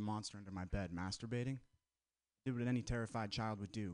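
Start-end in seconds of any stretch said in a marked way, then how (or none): none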